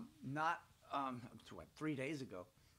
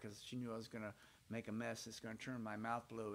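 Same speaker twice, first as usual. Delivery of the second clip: normal talking voice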